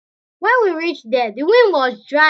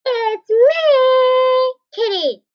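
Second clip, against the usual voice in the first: high squeaky cat character; kitty voice; 11 semitones higher, 5 dB louder, much slower than usual. Pretend. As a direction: play the kitty, high and bright.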